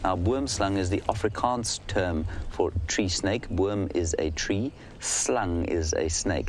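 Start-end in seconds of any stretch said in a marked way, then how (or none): 3.5-6.5 slow, careful explanation; said slowly. Shown as a demonstration. Guide answering Lois's request, saying it slowly. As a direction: spell out the meaning slowly for the viewer. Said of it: "pronounce the name of the snake slowly and to say it slowly".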